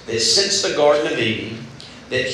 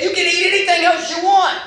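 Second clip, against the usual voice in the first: excited God; 13 semitones higher than usual. Retold or imitated. Preacher voicing God's lavish offer to Adam, excited. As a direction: throw the offer wide open. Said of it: "he said".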